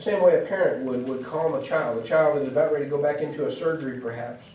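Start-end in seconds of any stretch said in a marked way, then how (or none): none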